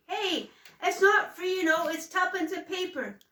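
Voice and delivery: shouting across the street; loud, high call. A narrator's reading. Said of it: "the wind snatched up Finn's voice".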